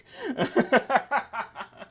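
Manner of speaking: high giggling laugh. Real, not acted. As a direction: giggle at the joke. Laughs at own joke.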